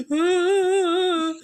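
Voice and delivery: high, loud mock scream of a scared kid; child's scream. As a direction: let out a kid's startled yelp, hammed up.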